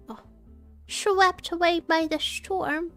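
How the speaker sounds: normal talking voice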